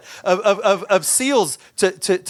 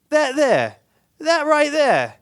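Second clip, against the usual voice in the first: incredulous captain; 11 semitones higher than usual. Retold or imitated. Captain pointing out sharks to frightened passenger, incredulous. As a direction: question it in a raised captain's voice, pausing between phrases.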